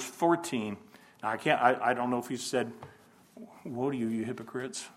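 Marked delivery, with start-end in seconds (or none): 3.6-4.9 quiet woe. Shown as a demonstration. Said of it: "Did he say it like that?".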